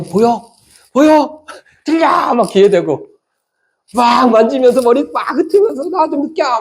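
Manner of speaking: very high, straining cries; urgent acted pleading. Preacher acting God trying to be noticed, high urgent calls. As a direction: cry out high and loud to get through.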